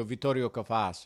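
lightly put-on Italian pronunciation; Italian accent. Shown as a demonstration. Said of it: "in an Italian accent".

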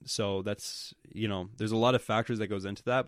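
normal talking voice

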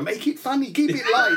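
high, light mock director whisper; mouthed director cue. Co-host mimics the producer mouthing cues, lightly. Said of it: "he sort of mouth things like".

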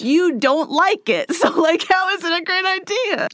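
high-pitched, laughing exasperation; mock incredulity. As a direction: argue back in comic disbelief. Mock-arguing with a listener's review.

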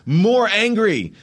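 loud, high, slow barked order; barked direction. Retold or imitated. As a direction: stretch out each word as a command. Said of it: "to just bark out adjectives".

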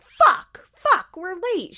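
high, loud mock panic; stressed out. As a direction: imitate a stressed mom cursing, pitch jumping up. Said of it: "so stressed out, being like".

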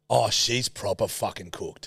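ordinary talk

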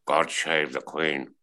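low, slow Scottish drawl; slow, low Sean Connery Scottish accent; Sean Connery impression. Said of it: "I did my Sean Connery impression".

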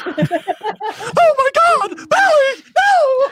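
high, loud laughing shriek; horror scream. Acted.

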